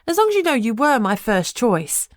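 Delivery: lightly voiced Lucy. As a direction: tease her friend in a light, bright voice. Lucy teasing on the phone.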